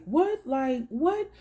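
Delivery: high, startled double take; retelling a stranger's shocked reaction; shocked disbelief. Retold or imitated. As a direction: jump up in pitch in disbelief.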